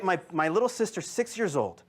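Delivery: ordinary talk, nothing marked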